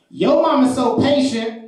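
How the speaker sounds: high, slow, emphatic setup; little girl's roast. Retold or imitated. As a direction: imitate a kid announcing a joke, drawn out. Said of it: "looks at this girl and she says".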